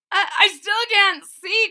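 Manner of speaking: high, loud stammering cry; high, loud, stammering complaint. Acted. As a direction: call out flustered and blind. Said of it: "cried Wilson".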